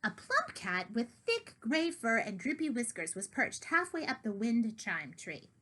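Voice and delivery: ordinary delivery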